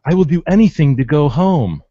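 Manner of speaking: high, loud hammed-up plea; pleading quote. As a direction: beg loudly as the detained woman. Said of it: "She starts saying things like".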